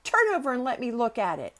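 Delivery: high, loud scolding; fierce contradiction. A narrator's reading. Girl dismissing boy's imagined lumps, fierce contradiction. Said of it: "contradicted Mary fiercely".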